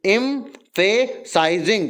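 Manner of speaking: slow एम्फेसाइजिंग, pausing between its syllables. Shown as a demonstration. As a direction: break एम्फेसाइजिंग into separate chunks. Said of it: "तोड़ तोड़ के पढ़ो ना". (एम्फेसाइजिंग को तोड़ तोड़ के पढ़ो)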